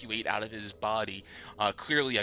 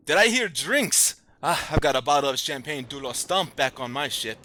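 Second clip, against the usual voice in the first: Grimzald; 3 semitones higher, 7 dB louder than usual. Acted. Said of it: "you hear Grimzald in the back say".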